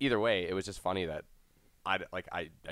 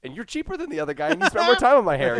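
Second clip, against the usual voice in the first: excited client; 9 dB louder than usual. Retold or imitated. Client gushing over haircut.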